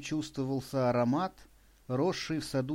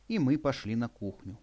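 ordinary delivery

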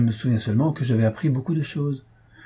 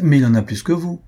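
quick-witted comeback, higher and louder; lively retort; 4 semitones higher, 5 dB louder than usual. A narrator's reading. Louise teases Waldorf about his beard, lively. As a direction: toss it back with spirit. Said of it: "ajouta vivement Louise".

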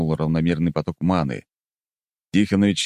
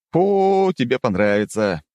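drawn out; 7 semitones higher, 4 dB louder, slower than usual. Acted. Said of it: "протянул я".

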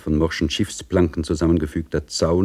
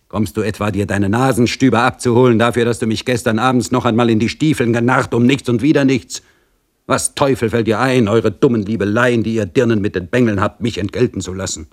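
louder, higher scolding rant; gruff scolding official; 6 semitones higher, 4 dB louder than usual. Acted. Pristav scolding Dorchen for the wasted night, louder. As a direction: berate the foolish girl.

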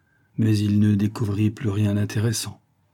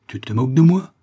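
louder angry growl; 3 semitones higher, 6 dB louder than usual. Acted. Giant growls at the calm detective, louder. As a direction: snarl the question at him. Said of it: "gronda Erigald".

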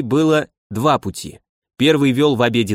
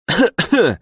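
smoke cough; 6 semitones higher than usual. Acted. Smoke filling the secret passage.

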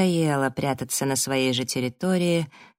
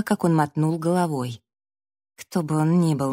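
ordinary delivery

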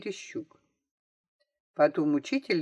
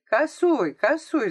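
high, insistent mild shout; calling out; 8 semitones higher, 4 dB louder, slower than usual. A narrator's reading. Several classmates shout correction to girl, high calling out. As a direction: call the hint out, pitched up. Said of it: "закричало несколько голосов".

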